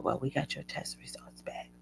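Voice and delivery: soft, breathy-quiet retold doctor; hushed voice of the doctor on the phone; doctor's news. Doctor calling with results, hushed. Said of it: "And he said".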